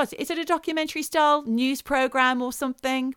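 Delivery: ordinary talk, nothing marked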